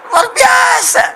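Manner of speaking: very high, full-force exclamation; proud shout. Real, not acted. Preacher hailing Indonesia before the crowd, high proud shout. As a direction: cry it out in amazement.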